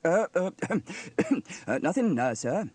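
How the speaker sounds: awkward throat-clearing and stammering; coughing hesitation. A narrator's reading. Sam hides the joke from Steele, coughing awkwardly. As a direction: cough and falter through an evasive answer. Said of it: "coughed Sam".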